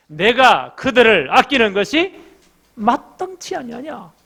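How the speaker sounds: slow, high and loud pleading question; God's impassioned plea. Acted. God to Jonah, slow loud pleading question. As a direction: press each word like a grieving father.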